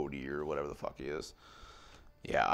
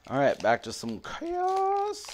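much higher, slow announcer cry; mock hype voice; 4 dB louder, slower than usual. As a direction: sing out the hype line high. Pumping himself up for pack.

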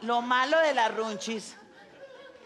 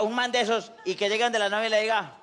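ordinary talk

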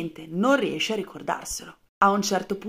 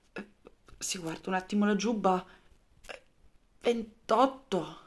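hesitant; 4 dB quieter than usual. Acted. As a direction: drag it out, unsure. Soldier unsure, checks his jacket.

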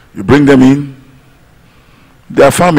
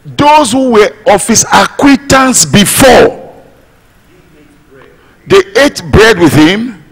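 preaching at full force; 6 semitones higher than usual. Acted. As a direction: lift the voice and draw out each clause like a sermon climax. Preaching climax.

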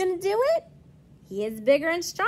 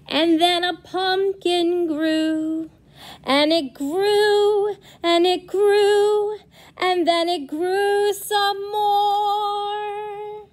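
building story voice; 5 semitones higher, 5 dB louder, slower than usual. A narrator's reading. Pumpkin keeps growing, building.

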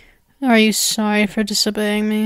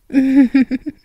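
a short, amused villain's giggle; sinister giggle; 2 semitones higher, 4 dB louder than usual. Acted. Spider captor giggling at her obedient human.

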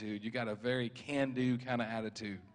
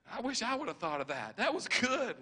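pleased amazement; 10 semitones higher than usual. Retold or imitated. Preacher voicing a man impressed by his own answer, amazed. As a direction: voice leaping high on the praise.

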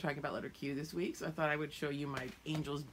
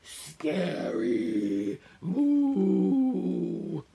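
scary monster boo; 3 semitones higher, 10 dB louder, much slower than usual. Acted. Green monster scaring.

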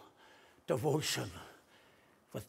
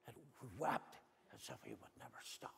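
hushed; 8 semitones lower, 13 dB quieter, faster than usual. Acted. Hushed climax of weeping.

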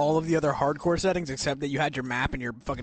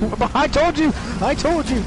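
higher, louder I-told-you yell; told you shout; 7 semitones higher, 5 dB louder than usual. Real, not acted. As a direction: shout it in vindication.

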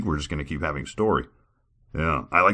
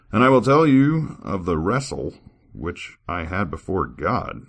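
scripture reading; 3 semitones higher, 5 dB louder, slower than usual. Acted. Reader performing a scripture passage for viewers, formal. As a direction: read it like solemn old scripture.

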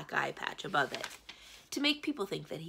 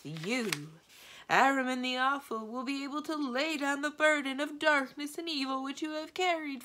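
pompous posh chant, building higher and louder to the end; posh droning prophecy; 6 semitones higher, 5 dB louder than usual. A narrator's reading. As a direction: intone it like a grand fortune-teller, stretching the words. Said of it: "put on a posh voice and droned".

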